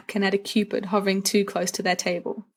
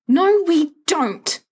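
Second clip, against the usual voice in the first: a sharp, higher, louder snap, drawn out slowly; snapping; 9 semitones higher, 5 dB louder, much slower than usual. A narrator's reading. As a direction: bite the refusal off. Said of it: "Remus snaps".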